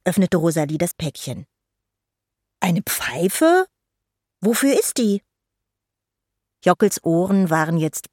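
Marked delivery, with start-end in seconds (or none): 2.6-5.2 put-on slightly higher, curious child; lightly voiced wondering fairy; puzzled question. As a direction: ask with mild surprise. Fairy puzzled by her present.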